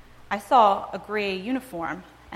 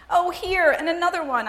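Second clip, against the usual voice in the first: high, rising wail of dismay; a lightly played wail of despair; 9 semitones higher, 3 dB louder than usual. A narrator's reading. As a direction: wail it, close to tears. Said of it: "I wailed".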